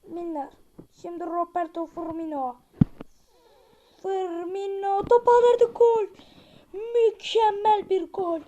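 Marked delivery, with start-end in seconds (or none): 4.0-8.4 drawn-out loud goal shout; slow, loud cheer for the goal; excited goal call. Real, not acted. Goal scored in football game.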